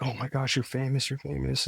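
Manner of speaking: mock the room's excited squeals; excited gushing. Retelling how the room gushed at his famous ancestor, excited and high.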